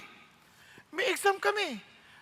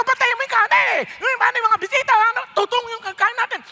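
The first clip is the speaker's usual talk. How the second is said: higher, louder angry yell; angry shouting; 4 semitones higher, 4 dB louder than usual. Retold or imitated. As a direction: shout at the cook in anger. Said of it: "galit na galit, sumisigaw". (galit na sigaw)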